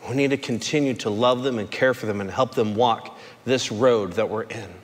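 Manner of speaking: low, soft sincere appeal; quiet earnest preaching. Acted. Preacher appealing to the recovery room, low and soft.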